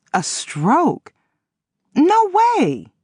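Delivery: rising to a high cry of disbelief; no way, shocked high. A narrator's reading. Woman hears her friend had a stroke, shocked high cry.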